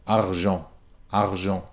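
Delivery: argent with the R rolled at the back of the tongue; rolled French R. Shown as a demonstration. Teacher rolls the R in argent at the back. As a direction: roll the R of argent far back in the throat. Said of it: "I can roll it at the back of my tongue".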